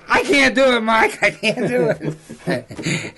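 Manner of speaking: high, laughing, drawn-out refusal; scared hunter. Retold or imitated. Hunter tells the guide he can't grab the tail. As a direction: mimic a man too scared to go on. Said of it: "He goes".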